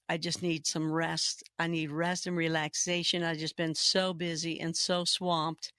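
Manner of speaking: ordinary talk, nothing marked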